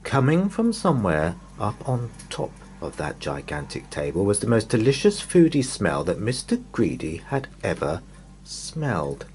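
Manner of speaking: ordinary delivery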